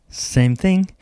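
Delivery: ordinary talk, nothing marked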